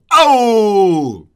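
loud comic "Oh!" after the joke; punchline shout. Acted. Roaster caps a nursery-rhyme joke with a loud Oh. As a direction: belt out a hammy "Oh!" like a rimshot.

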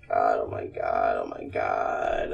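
hushed, low, barely voiced repetition; frightened muttering. Real, not acted. Scared player dreads the next room, hushed fear. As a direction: mutter it under the breath in dread.

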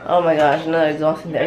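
ordinary talk

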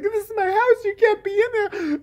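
hammed-up high outrage; mock protest. Wife tells intruders off, mock high protest.

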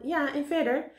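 high, prompting call. A narrator's reading. Meneer Pen prompts Karel for the rest, calling out. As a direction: prod for the rest from below.

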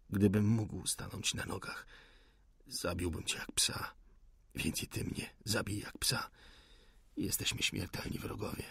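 faint, hushed, dying defiance; weak wounded rebel. Acted. The wounded rebel dares the officer to shoot, barely whispering. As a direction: whisper your challenge through the pain.

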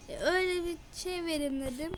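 ordinary talk

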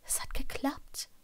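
hushed, half-whispered; cautious breathed words. A narrator's reading. Patient cautiously realising it worked, breathed softly. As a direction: barely breathe it, carefully. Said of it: "hauchte sie vorsichtig".

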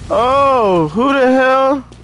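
high, slow startled cry; surprised. Real, not acted. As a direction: caught off guard by an enemy. Shocked by game enemy.

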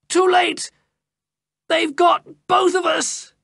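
high, loud cry of alarm; a trapped man crying that all is lost; cried out. A narrator's reading. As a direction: shout the warning in panic. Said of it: "cried another voice".

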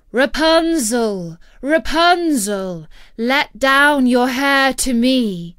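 slow, loud call up to the tower. A narrator's reading. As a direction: call out long and clear to someone high above. Prince calls up the tower.

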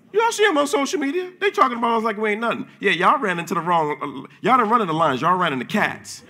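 high gleeful preaching taunt; excited taunt. Real, not acted. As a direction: lift it and mock the witches.

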